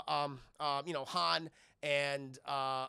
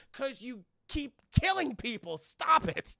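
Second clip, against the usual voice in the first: high, raised-voice reprimand; loud, pitched-up telling-off; mock exasperated scolding; 6 semitones higher, 5 dB louder than usual. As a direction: burst out in comic outrage. Scolds Vader in mock outrage.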